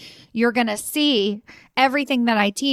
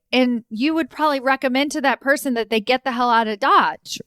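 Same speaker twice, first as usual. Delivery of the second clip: normal talking voice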